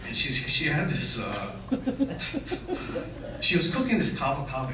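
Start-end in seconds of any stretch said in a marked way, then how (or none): 1.6-3.3 high airy laugh; breathy laugh. Real, not acted. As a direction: laugh out in delight. Laughing at grandma's cooking.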